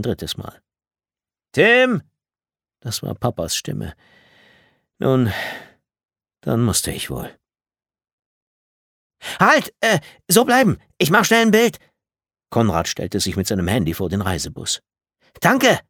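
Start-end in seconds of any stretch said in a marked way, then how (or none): 1.5-2.0 high, loud call for someone. A narrator's reading. Father calls his son from downstairs, calling out. 9.4-11.8 high, loud, eager shout; excited calling out. A narrator's reading. Konrad wants a photo of the bus, excited calling out. 15.4-15.8 high, loud quick shout; calling out. A narrator's reading. Konrad thanks them after his photo, calling out.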